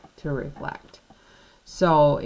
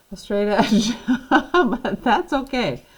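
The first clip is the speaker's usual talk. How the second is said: laughing; 6 semitones higher, 6 dB louder than usual. Real, not acted. Laughs at own crooked line.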